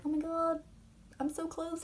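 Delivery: high, breathless little thrill; mock thrilled gasp. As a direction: squeak it out like a lovestruck boy. Kaz's inner thrill voiced.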